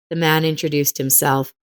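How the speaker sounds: ordinary talk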